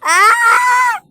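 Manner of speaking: sharp, very high, loud shriek; high scream. Real, not acted. Adult tussling with child, shrieks loudly. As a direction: let out a piercing squeal.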